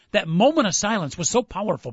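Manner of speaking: normal talking voice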